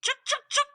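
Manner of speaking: high chattering squirrel scold; sharp high squirrel chuks. Acted. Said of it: "sprang into action".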